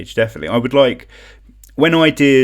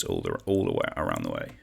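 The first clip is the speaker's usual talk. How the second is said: deep radio voice; 8 dB quieter than usual. Acted. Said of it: "drops voice low after the song".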